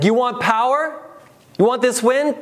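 God teasing. Acted. God voiced mocking the demand.

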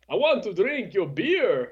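vampire voice. Acted. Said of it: "without your Halloween jokes".

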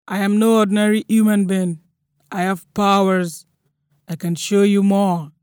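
loud, forceful proclamation; loud, imposing warning to frighten someone off; booming threat. A narrator's reading. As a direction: declare supernatural powers at full volume. Threatening with claimed animal-sound powers.

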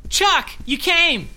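high, loud, slow relief; relieved exclamation. Acted. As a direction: greet him with relief. Relieved greeting.

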